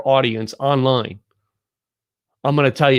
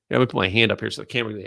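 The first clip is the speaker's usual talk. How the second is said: normal talking voice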